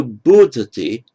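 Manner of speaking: bodhati with a strong, loud BO; stress on first syllable of bodhati. Shown as a demonstration. Teacher showing a strengthened vowel, first-syllable stress on bodhati. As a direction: lean on the opening "bo" of bodhati. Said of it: "because it is stressed".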